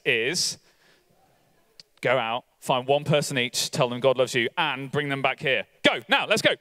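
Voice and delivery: ordinary talk, nothing marked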